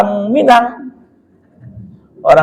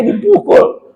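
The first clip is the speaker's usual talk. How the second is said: normal talking voice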